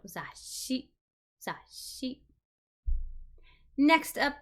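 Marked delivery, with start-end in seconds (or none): 0.0-2.2 "Za, shi" softly, with a long s in the middle; quiet "za, shi", the middle s lengthened; drawn-out S. Shown as a demonstration. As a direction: let the s hiss through each "za, shi". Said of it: "an S kind of drawn out in the middle".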